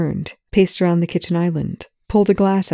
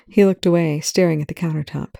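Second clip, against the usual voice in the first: ordinary delivery, nothing marked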